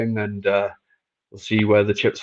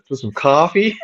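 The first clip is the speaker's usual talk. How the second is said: Englishman attempting an American accent, pitched up; 5 semitones higher, 5 dB louder than usual. Shown as a demonstration. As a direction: try your best American "coffee". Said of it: "do your best American accent".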